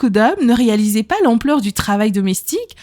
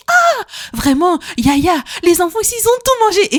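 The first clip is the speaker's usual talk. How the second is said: imitating her mother; 8 semitones higher than usual. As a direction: imitate mom marvelling. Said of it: "maman me dit".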